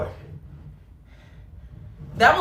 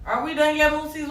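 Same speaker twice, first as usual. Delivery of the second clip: pitched higher, called out; calling out; 6 semitones higher than usual. Real, not acted. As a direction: call it up to the viewers. Calling out to viewers, rising.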